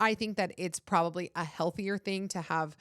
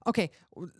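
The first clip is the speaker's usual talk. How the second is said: ordinary talk, nothing marked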